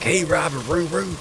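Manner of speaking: bright high cheery hello; mock streamer greeting. Imitating the streamer calling out his old name cheerfully. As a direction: greet him like the streamer did.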